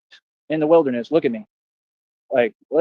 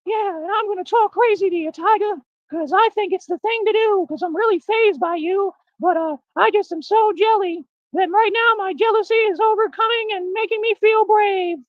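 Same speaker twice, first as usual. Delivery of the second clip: very high squeaky put-on voice, drawn out; mock a wannabe-tough little cat in falsetto; mocking domestic cat. Little cat talking big to a tiger, mocking falsetto. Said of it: "little domestic cats don't just roll up on tigers and then say".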